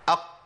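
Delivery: أق with the qaf stopped and bounced; qalqala demo. Shown as a demonstration. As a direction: stop the tongue on the qaf in أق. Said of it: "قول كده".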